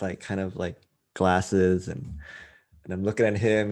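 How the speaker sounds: ordinary talk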